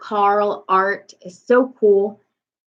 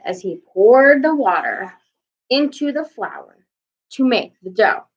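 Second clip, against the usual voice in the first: ordinary delivery, nothing marked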